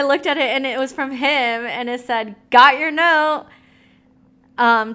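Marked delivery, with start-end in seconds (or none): 2.5-4.9 higher, much louder punch on Got; mock dramatic reading. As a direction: blast the text out in disbelief. Reading his text second time.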